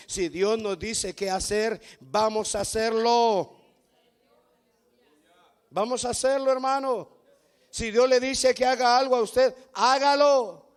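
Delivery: loud, slow, rising preacher's cry; fiery preaching. Acted. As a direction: thunder it out to the congregation. Preaching at full force. (predicador que clama con fuerza a la congregación)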